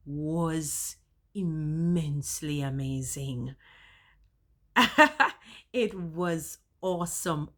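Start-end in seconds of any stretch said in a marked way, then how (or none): 4.7-5.7 high, loud burst of laughter; bright laugh. Real, not acted. Vlogger laughing over how amazing the class was. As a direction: laugh out, delighted.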